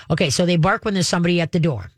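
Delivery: normal talking voice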